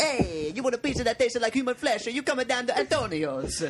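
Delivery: mock Italian accent. Pizza man pitches coffin pizza to a customer in hammed-up Italian accent. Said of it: "offensive Italian accents".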